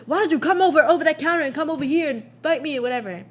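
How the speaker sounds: high, loud mock challenge; challenging yell. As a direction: dare her to come over the counter, shouting. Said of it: "he was like".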